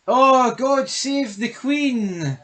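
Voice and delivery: high, slow, hammed-up posh English accent; mock posh English. Mocking the show's British characters in a hammed-up posh English accent. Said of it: "And even the way".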